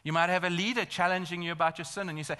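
ordinary talk, nothing marked

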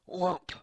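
high punchy whomp sound effect; voiced comic sound effect; mock punch sound. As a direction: slap out a comic-book hit noise.

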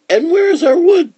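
put-on very high, loud boy's voice; piping, insistent little brother; demanding boy. A narrator's reading. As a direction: ask in a loud, high-pitched child voice. Brother demands the missing wood.

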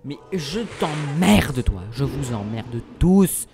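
slow defiant swearing at the game; defiant insult. Real, not acted. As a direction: spit it out slowly and defiantly.

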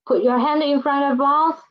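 normal talking voice

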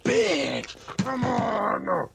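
loud, drawn-out cry of frustration; exasperated yell. Real, not acted. Player who keeps dying in the game, exasperated yell. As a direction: wail in protest at the game.

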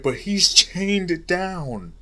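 ordinary delivery

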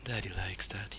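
soft, low, playful whisper; teasing whisper. Acted. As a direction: tease it out like a mock seduction. Teasing game enemy with daddy talk.

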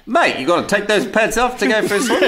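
put-on high-pitched parody of an Australian accent; mock Australian accent. Podcaster imitating co-host's beach line, mock Australian accent. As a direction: send up his Aussie accent, amused. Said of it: "Incredible accent".

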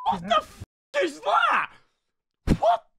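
shocked shout. Real, not acted. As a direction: yell out in pure disbelief. Shocked by clip.

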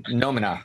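"Nomina" with an O in the first syllable, as NO-mi-na; suggested pronunciation. Shown as a demonstration. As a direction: offer "Nomina" as your own way, opening on "no". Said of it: "I would say".

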